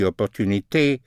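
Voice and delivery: "opportunité" with final "té" sounded as "tay"; French -té ending. Shown as a demonstration. Teacher showing the French ending of English -ty words, "opportunité" ending in "tay". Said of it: "all words in T are T in French".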